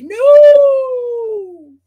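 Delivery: high, loud drawn-out no; mock protest yell. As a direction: wail no in mock horror. Friend protests in retold scene.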